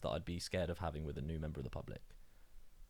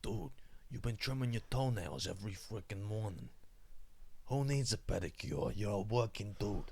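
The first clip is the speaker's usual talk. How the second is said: parent impression. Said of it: "done the impressions of my parents".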